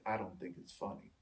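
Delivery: hushed imitation of a serious comedian; dead serious. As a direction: drop the voice low and soft, stone-faced. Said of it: "looking dead serious, Seinfeld said".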